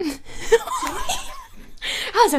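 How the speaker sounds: high shrieking through laughter; laughing shriek. Real, not acted. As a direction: shriek it out while cracking up.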